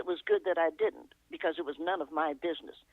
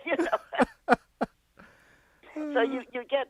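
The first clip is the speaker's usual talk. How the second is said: laughing; 8 semitones higher, 3 dB louder, slower than usual. Real, not acted. Laughing after the fan story.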